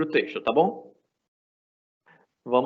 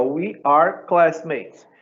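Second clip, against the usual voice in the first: slow dictation; 3 semitones higher, much slower than usual. Shown as a demonstration. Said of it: "teacher dictating example sentence".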